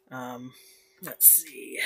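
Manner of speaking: soft unvoiced whisper; whispered to self. Real, not acted. Whispering to himself while searching for a topic, soft. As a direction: whisper it to yourself.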